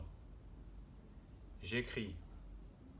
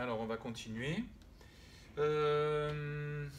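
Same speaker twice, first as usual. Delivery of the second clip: ordinary talk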